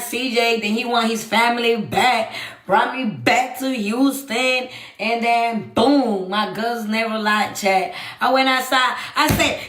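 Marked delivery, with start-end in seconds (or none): none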